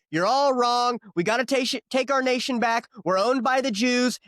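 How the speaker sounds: angry rant. Pretend. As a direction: rail at everyone at full tilt. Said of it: "I wanna get mad and say".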